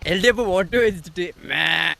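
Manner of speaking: slowed, dragged-out parody of her words; mimicking the candidate's speech in slow motion; mock slow imitation. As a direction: drag every word out to ridicule her. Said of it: "slow ആക്കിയിട്ട് നാണം കെടുത്താണെന്ന്".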